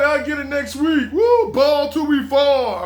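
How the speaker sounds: hammed-up loud party cheer, slow at the end; rowdy party shout. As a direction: shout it like a friend blowing money. Spendthrift partying friends.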